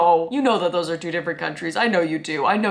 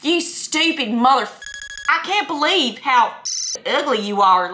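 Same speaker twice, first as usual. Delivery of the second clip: cussing in a raised, put-on country accent; 4 semitones higher, 4 dB louder than usual. Country speaker cussing you out, mock country accent. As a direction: cuss sweetly with a country twang. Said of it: "talks in a country accent".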